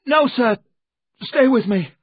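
high, loud frightened pleading; desperate high-pitched begging; panicked cry. A narrator's reading. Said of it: "he cried".

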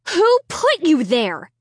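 slow, loud demanding question. Acted. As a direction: press hard for an answer. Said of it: "I demanded".

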